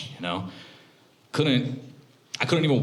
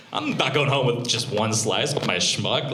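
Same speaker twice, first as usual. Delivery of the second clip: ordinary talk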